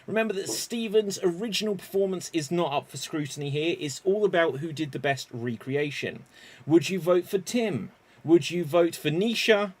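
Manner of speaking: ordinary delivery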